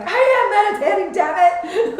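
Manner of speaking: high, loud indignant yell; comic fury, on the edge of laughter; mock mad. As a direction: shout back in hammed-up outrage. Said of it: "I was so mad".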